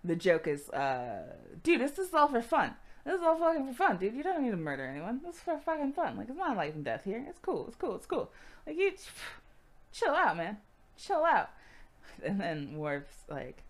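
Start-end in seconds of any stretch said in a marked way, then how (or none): 1.6-11.5 high-pitched mock pleading; urgent, hammed-up appeal to relax; exasperated placating. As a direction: talk an overzealous buddy down. Voicing Riker calming an overzealous Worf.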